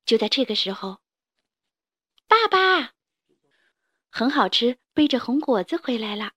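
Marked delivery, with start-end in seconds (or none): 2.3-2.9 put-on high, far-carrying child's call; 孩子喊爸爸. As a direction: call out to dad as a little kid. 小恐龙远远喊爸爸. (小孩子高声喊爸爸)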